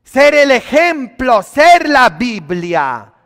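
loud, high preaching cry; fiery sermon delivery; full-force preaching. Acted. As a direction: preach it out to the whole church.

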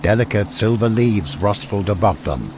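ordinary delivery, nothing marked

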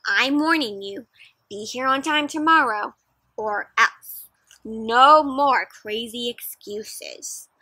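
put-on teacher warning the boys, stern. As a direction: lay down the law. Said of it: "cried their teacher".

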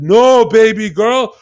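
arrogant declaration. Retold or imitated. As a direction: lay it on thick, savouring each word.

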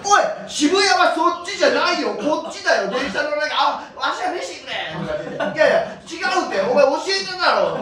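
loud, high mock yell from the men left behind; shouting old man. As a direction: holler after them, half laughing.

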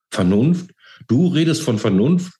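high, rising incredulous questions; mild tearfulness, pitched high; tearful retort. A narrator's reading. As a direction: throw the word back, upset. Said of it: "gab sie schluchzend zurück".

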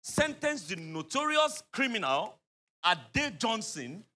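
slow, weighty build-up; slow and emphatic. Acted. Lawyer impressing on client how fearsome the judge is, slow and weighty. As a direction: spell out her record slowly and heavily.

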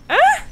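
short high startled yelp. Real, not acted. Gamer jumps at a scare, startled high yelp. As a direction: yelp sharply at a sudden scare.

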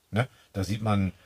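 ordinary delivery

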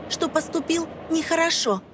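usual speaking voice

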